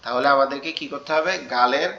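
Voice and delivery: ordinary talk, nothing marked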